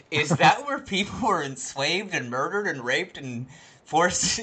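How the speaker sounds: mock detached curiosity. Mimicking old lady as if on a studio tour, mock detached curiosity. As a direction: list horrors like sightseeing attractions. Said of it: "like, completely detached".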